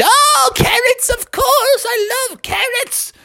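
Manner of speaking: very high, slow swooning delight; delighted snowman. A narrator's reading. Snowman finding the carrot pile, swooning delight. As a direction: melt with joy over the carrots.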